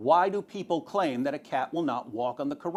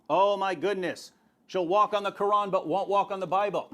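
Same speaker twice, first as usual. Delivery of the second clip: normal talking voice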